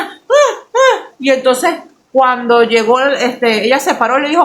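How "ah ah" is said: short high-pitched imitated yelps; mock pained cries. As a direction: yelp as if hit, hammed up. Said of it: "y ella hacía".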